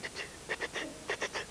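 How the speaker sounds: soft unvoiced tut tut tut; a wee elf tutting over the typewriter, softly. A narrator's reading. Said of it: "wee green elf going tut".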